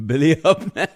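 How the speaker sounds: loud, high, through laughter; laughing. Real, not acted. Reading out a viewer's name, laughing.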